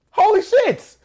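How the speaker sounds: high-pitched, loud burst of surprise; shocked yelp. Real, not acted. A coach, stunned by a smooth text, yelps in shock. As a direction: blurt it out, stunned.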